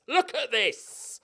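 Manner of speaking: high, slow, savouring "Look at this."; drawn out. Pretend. To viewers, showing off the offer, high and slow. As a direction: stretch "Look at this." out high and slow, like a proud showman.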